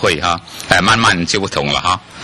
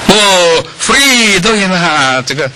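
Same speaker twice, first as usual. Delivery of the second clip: high, loud delighted oh free; light up at the answer; excited realisation; 12 semitones higher, 5 dB louder, much slower than usual. Real, not acted.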